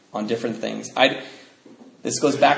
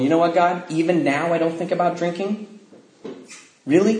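indignant at God; 5 semitones higher than usual. Retold or imitated. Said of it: "And I was pissed".

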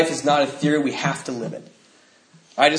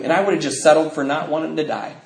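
ordinary delivery

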